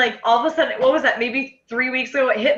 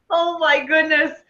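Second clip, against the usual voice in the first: slow, drawn-out, higher exclamation; retold amazed realization; 4 semitones higher, slower than usual. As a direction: stretch it out as the realization lands. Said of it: "I was like".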